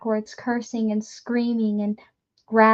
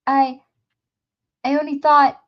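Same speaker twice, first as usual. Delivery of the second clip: frightened stammer; 4 semitones higher, 8 dB louder than usual. Acted. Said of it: "Hoots began".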